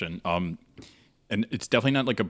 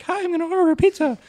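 put-on much higher, fast, childlike phone voice; high young voice; 7 dB louder, faster than usual. Man imitating his unbroken voice ordering a pizza, high. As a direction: squeak it out like an unbroken voice. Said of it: "my voice did not change until".

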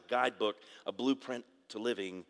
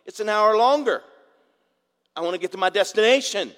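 loud, much higher protest; exasperated mock shout; 11 semitones higher, 13 dB louder than usual. Husband mock-shouting back at the ladies about the long route, loud and exasperated. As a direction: shout it like a fed-up driver. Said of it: "I'm like".

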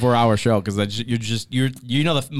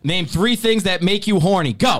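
slow, higher host reading; game host read; 5 semitones higher, slower than usual. Acted. Reading the card for the party game, slow host voice.

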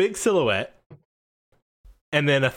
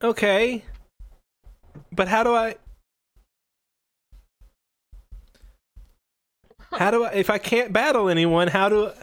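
put-on high, faltering child's voice; worried kid trainer; 6 semitones higher than usual. Young trainer asking referee how he can battle, worried. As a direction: falter through the question in a high voice.

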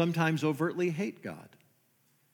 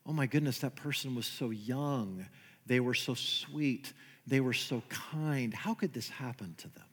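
soft, lower sympathetic murmur; a hint of a sorrowful church friend; pitying churchgoer; 5 semitones lower, 9 dB quieter than usual. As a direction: sigh it out like someone shaking their head at a funeral. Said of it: "We talk like that".

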